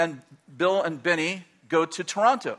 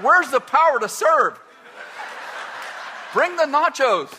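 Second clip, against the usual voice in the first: mock complaint; 8 semitones higher, 4 dB louder than usual. Mock complaint to tipsy wife.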